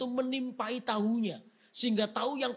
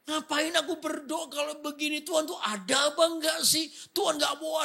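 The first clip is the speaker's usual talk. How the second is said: grumbling at God; 8 semitones higher than usual. Retold or imitated. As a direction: rant at God, half shouting. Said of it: "Dia ngomel-ngomel dengan Tuhan".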